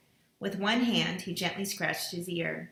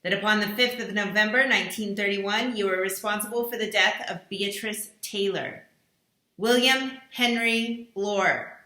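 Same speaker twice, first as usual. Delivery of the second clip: accusing recorded voice; 2 semitones higher, 5 dB louder than usual. Acted. Mysterious voice accusing each dinner guest, stern and solemn. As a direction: pronounce the charges like a judge's sentence.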